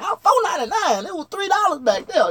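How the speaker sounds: shocked. Retold or imitated. Shock at price.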